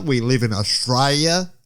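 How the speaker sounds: mock Australian drawl. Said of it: "talking like this for Australia".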